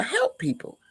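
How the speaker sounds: ordinary talk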